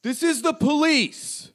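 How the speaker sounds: high, projected official announcement; police authority. Acted. Police call up to the fighters, high-pitched authoritative call. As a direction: announce it from afar as a commanding cop. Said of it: "flexing his authority".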